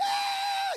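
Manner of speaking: mock tantrum wail, high and loud; child's whiny shout. Boy's tantrum wail at his mother in the supermarket. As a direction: shriek like a spoiled kid in a supermarket. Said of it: "e o menino".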